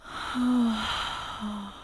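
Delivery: drawn-out, spent sigh; exhausted exhale; long sigh. Acted. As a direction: let out a long breath. Narrator performs character's sigh.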